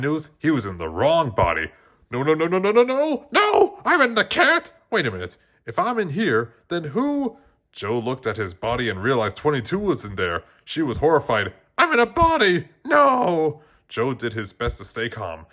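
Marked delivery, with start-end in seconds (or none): 2.1-7.4 higher and higher, louder panicked no's; rising panic to a squeal. A narrator's reading. Joe panics finding himself in the cat, shrieking. 11.8-13.6 high, loud horrified cry. A narrator's reading. Twenty-two wails on waking in a body, horrified.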